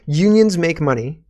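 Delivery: usual speaking voice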